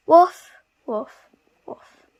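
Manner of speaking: two loud barks, like a dog at play. Acted.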